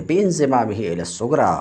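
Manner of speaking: tossed-off recital of a familiar line; a quick, low aside quoting the text; very fast recitation. Shown as a demonstration. Teacher recites the Arabic text again.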